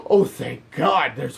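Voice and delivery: high gasp of joyful surprise; delighted gasp. Retold or imitated. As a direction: gasp like you just spotted an old friend. Delighted to see Wesker.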